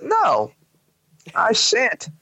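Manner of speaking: high, mock-formal refusal; mock defiant. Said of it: "I'd be like".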